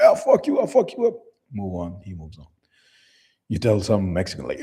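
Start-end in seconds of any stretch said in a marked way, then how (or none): 0.0-1.1 high, louder mock outburst; mock angry yell. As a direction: yelp it like a threatened tough guy. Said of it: "You're like".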